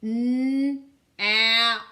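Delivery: N then ap, said loud; segmenting sounds. Shown as a demonstration. Teacher segmenting nap for the class, loud and clear. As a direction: split nap into N and ap.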